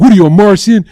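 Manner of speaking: loud, high imitated jab; imitating a debate rival's loud jab; mock loud retort. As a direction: throw the accusation back like the opponent.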